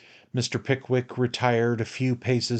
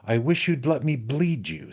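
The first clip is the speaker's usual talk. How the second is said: mildly eager, lifted offer; 4 semitones higher than usual. A narrator's reading. Surgeon offers to bleed his friend, eager. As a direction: offer it with keen relish, voice raised a little. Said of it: "with great eagerness".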